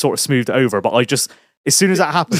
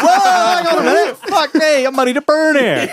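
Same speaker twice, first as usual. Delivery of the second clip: pitched way up, a hammed-up whoa; mock startled outburst; 13 semitones higher, 4 dB louder than usual. Telling mates how he reacted at the bar, mock startled. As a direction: play the shock big and high. Retelling his shock at the pub.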